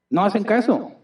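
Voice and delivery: normal talking voice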